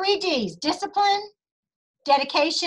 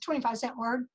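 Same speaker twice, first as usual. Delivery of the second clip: ordinary talk, nothing marked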